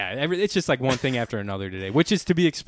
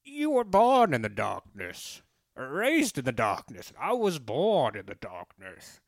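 slow masked villain, more like Darth Vader; bad Bane impression; slower than usual. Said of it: "it's almost like, uh, Darth Vader".

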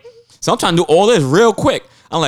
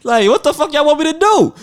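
high, raised-voice retold exasperation; exasperated outburst; 10 semitones higher, 3 dB louder than usual. Traveler retelling his outburst at the airline agent, exasperated. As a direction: demand an answer, hammed up and indignant.

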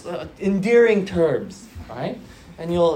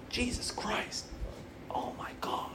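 whispered swear; whispering a casual oath; 3 semitones higher, 18 dB quieter than usual. Shown as a demonstration. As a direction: breathe the curse out in a whisper. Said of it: "if I s-, whisper it".

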